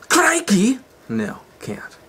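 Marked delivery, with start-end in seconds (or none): 0.1-0.8 loud, high mock-Australian exclamation; badly faked Aussie accent, pitched up; attempted Australian accent. Shown as a demonstration. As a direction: have a poor stab at an Australian accent. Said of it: "I can't do an Australian accent".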